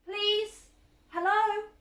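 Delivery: loud, high calling out into the dark; loud anxious call, pitched high. A narrator's reading. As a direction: call out for anyone to hear. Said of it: "She called out".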